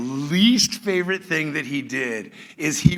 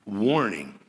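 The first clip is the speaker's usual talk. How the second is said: normal talking voice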